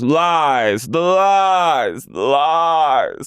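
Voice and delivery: dramatic drawn-out lies; imitating Meredith. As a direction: moan the words theatrically. Mimicking Meredith's dramatic denial.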